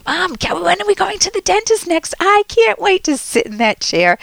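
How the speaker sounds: high-pitched pretend child begging Mom; mock eager kid. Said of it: "think about yourself as a kid".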